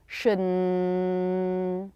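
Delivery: Shouldn with the N held long. Shown as a demonstration. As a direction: hold out the N in Shouldn. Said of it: "hold out an N".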